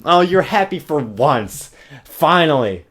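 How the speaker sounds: loud, slow mock approval; mock her rare happiness; loud ironic finally; sarcastic cheer. Real, not acted.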